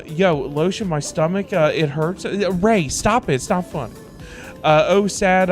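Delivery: ordinary delivery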